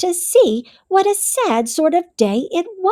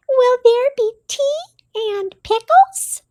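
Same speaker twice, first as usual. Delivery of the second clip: high, small mouse voice; little Mouse voice; 11 semitones higher, faster than usual. A narrator's reading. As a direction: speak as a tiny gentle mouse. Mouse asks hopefully.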